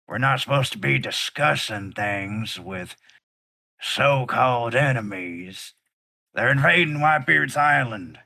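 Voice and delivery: a big bully urging violence; big brute. Acted. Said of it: "very large gentleman walks up and goes".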